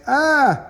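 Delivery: high startled "ah"; a short mock shriek at the hands; mock startled yelp. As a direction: jump at the scare, high-pitched. Scare moment in comic.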